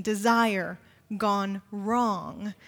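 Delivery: very slow, drawn out; preacher lingering on the phrase; slow refrain. Shown as a demonstration. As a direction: stretch each word. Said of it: "repeated refrain".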